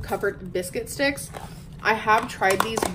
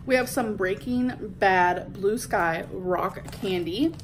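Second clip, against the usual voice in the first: normal talking voice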